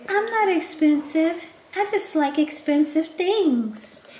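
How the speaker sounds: very high put-on little voice; playful squeaky comeback. Answering her own teasing about being expensive, high playful put-on voice. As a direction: answer back in a high mock-defensive voice.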